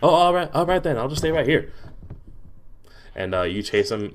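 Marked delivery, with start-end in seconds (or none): 0.0-1.7 much higher, louder fox voice; high-pitched fox companion; talking fox, obliging. Acted. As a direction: give in readily as an eager fox.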